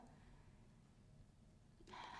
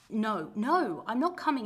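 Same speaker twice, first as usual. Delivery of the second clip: louder, firm no; firm refusal; 2 semitones lower, 6 dB louder, slower than usual. Acted. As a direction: shut the mother down flat. Refusing mother on phone.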